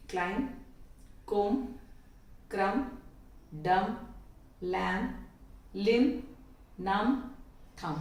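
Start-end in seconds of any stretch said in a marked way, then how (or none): none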